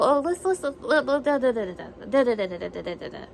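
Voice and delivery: ordinary talk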